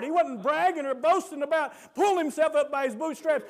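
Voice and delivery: ordinary talk, nothing marked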